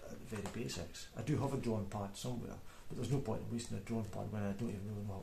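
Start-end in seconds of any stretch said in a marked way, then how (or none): none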